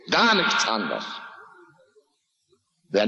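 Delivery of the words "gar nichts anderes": high, intense pulpit preaching, pressing each phrase; fiery preaching. Acted. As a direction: hammer the listener's guilt from the pulpit. Preaching climax on youth and sex.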